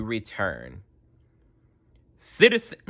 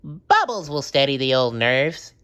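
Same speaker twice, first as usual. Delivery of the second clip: high cheerful cartoon sponge; chirpy, reassuring himself; SpongeBob voice; 5 semitones higher, 4 dB louder than usual. A narrator's reading. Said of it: "he said".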